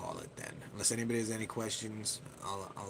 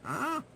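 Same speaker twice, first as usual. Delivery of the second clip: startled yelp; 6 dB louder than usual. Real, not acted. Startled by streaming controls.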